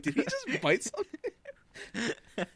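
high, slow, laughing disbelief; stunned question through laughter; amazed laugh. Retold or imitated. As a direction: ask it in giggling astonishment. Reacting to a cohost's on-mic bite.

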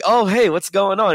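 breezy mock Southern greeting; lightly voiced Southern accent, friendly hello. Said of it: "He's got that little Southern accent".

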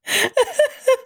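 high squeaky laugh; host laughs at his own logo joke. Real, not acted. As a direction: giggle up high.

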